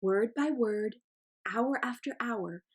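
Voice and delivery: plain reading voice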